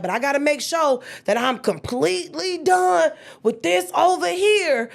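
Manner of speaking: animated explaining. Retold or imitated. Explaining herself to best friend.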